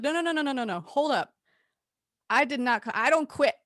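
high insistent no no no; retold protest. [name] denying she quit, high insistent. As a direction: push back hard, retold. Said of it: "And I was like".

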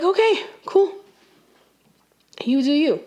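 high, sarcastic brush-off; sarcastic okay cool. Retold or imitated. Telling the guy off with a high, sarcastic okay cool. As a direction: toss it off with a high, mocking shrug.

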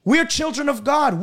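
ordinary delivery

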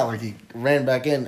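ordinary talk, nothing marked

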